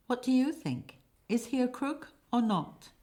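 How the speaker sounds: high, asking the crowd; high questioning. Acted. Asks the audience.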